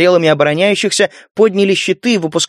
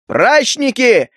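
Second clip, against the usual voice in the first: high, slow bellowed order; roaring command; 7 semitones higher, much slower than usual. Acted. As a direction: roar it across the battlefield. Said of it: "проревел Лука".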